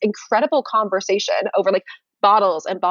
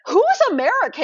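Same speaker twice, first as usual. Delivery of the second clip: high, puzzled question, pitched way up; mock baffled French friends; 12 semitones higher than usual. French friends quizzing her on American artists, a high puzzled question. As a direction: play the French friends stumped about who is American. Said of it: "And then they're like".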